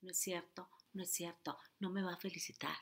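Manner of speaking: hushed sceptical inner voice; quiet inner voice. Shown as a demonstration. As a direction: say it under your breath like a hidden thought. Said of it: "Y aquí abajo estás".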